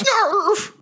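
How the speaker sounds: high squealed snarl; high creature yelp; goblin's attack noise. Acted. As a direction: squeal it like a small beast lunging. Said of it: "Goblin Junior goes".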